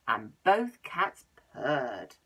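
slow low purr-like; purring slowly. A narrator's reading. Telling children the cats purred, slow purr.

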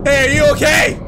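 loud call across the woods. Acted. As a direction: call out to a stranger far off. Calling to a figure in woods.

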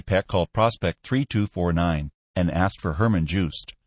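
ordinary delivery, nothing marked

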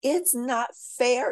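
put-on grown-ups whining like kids; mock whiny protest. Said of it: "yelled the grown-ups".

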